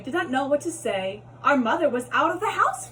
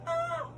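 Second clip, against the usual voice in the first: high startled cry; 6 dB quieter than usual. Acted. Cat falls on his head.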